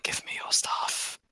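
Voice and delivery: whispered imitation of a greedy demand; hushed, voiceless mock demand. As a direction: whisper it as a teasing impression. Mimics party demanding dragon's treasure.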